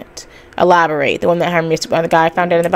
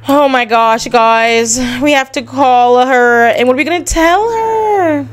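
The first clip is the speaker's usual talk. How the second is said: high, loud, worked-up gasp at the choice; panicking to the chat about the call; real high-pitched excitement, slowing down; excited alarm; 6 semitones higher, 4 dB louder, slower than usual. Real, not acted. Streamer reacts to game choice.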